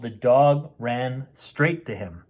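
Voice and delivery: ordinary delivery, nothing marked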